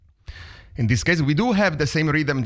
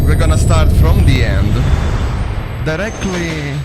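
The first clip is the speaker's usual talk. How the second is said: booming announcer intro; 5 semitones higher, 9 dB louder than usual. Acted. Host opening the show to viewers, big announcer voice. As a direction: boom it out like a trailer. Dramatic intro over music.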